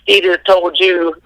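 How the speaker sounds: imitating friends' taunts, high and drawn out; rubbing it in. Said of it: "they started rubbing it in".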